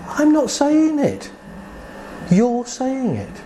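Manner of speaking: high, slow and emphatic; pointed rebuttal. Retold or imitated. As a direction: hand the blame back, word by word. Therapist turns words back on client.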